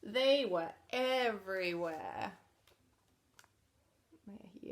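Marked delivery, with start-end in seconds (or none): 0.0-2.3 lively storybook read, a touch higher and louder; picture book narration; reading to children. Acted. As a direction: read it out to a room of kids.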